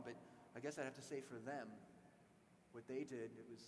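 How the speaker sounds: subdued and hurt. Retold or imitated. Man recalls friend lost on 9/11.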